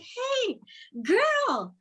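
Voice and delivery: imitating an excited, very high-pitched greeting. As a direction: call out high like a thrilled man. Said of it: "he sounds excited".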